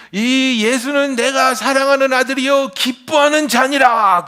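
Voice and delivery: loud, slow, high proclamation; booming voice of God. Acted. God's voice from heaven.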